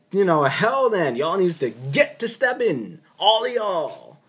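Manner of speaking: high, loud sassy get-going; sassy dismissal. Retold or imitated. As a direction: shoo them all out with attitude. Telling the officers to leave.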